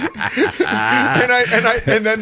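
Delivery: laughs through the words; can't hold back a laugh. Real, not acted. Retelling the funny outcome, laughing.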